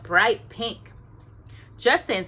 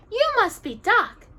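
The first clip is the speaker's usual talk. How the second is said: glad princess; 14 semitones higher, 5 dB louder than usual. A narrator's reading.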